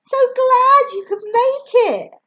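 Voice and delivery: high, loud delighted greeting; beaming. A narrator's reading. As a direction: gush with a big beaming welcome. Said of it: "I beam".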